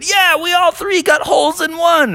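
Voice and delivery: high, excited shout; excited boast. Acted. Golfers telling old rhinoceros their feat, thrilled. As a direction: cry out the good news together.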